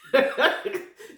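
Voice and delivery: a sudden high burst of laughter. Real, not acted. Laughs at her own story.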